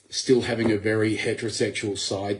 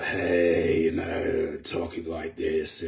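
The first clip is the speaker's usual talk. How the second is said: lower, softer just-woke-up voice; morning voice demo; 5 semitones lower, 4 dB quieter, much slower than usual. Shown as a demonstration. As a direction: sound like you've downed a quart of whiskey. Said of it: "sounds like I've just downed a quart of whiskey".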